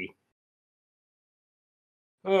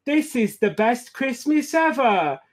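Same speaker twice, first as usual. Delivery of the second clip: high, cheering piglet voice; Peppa Pig; 7 semitones higher, slower than usual. Acted. Peppa cheering her Christmas, high piglet cheer. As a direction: cheer it in a squeaky cartoon child voice. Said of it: "cheers Peppa".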